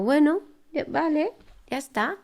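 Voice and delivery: high, airy mock shrug of "bueno, ya"; resigned dismissal. Telling herself to drop it after talking with her sisters, resigned and high. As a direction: brush it off with a sigh.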